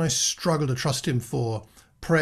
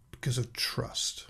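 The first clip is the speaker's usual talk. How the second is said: breathy emphatic whisper, much softer; 10 dB quieter than usual. Real, not acted. Preacher to congregation, closing a point in a whisper. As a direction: let the last words fall to a hushed whisper.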